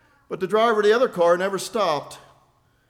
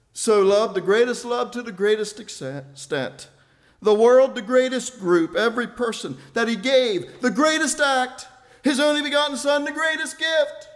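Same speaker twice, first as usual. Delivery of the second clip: climbing steadily higher and louder, phrase by phrase; rousing pulpit crescendo; building sermon; 7 semitones higher, 6 dB louder than usual. Acted. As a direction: stack each greatest into a crescendo. Preaching climb through the verse.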